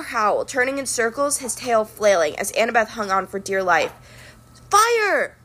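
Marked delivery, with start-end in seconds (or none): 4.7-5.4 high commanding shout; ordered fire. A narrator's reading. Zoe ordering the hunters to shoot, shouting. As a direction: snap the order to the archers. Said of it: "Zoe ordered".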